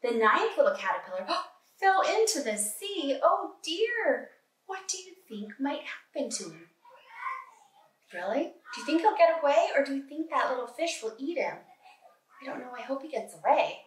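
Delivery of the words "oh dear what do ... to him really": ordinary talk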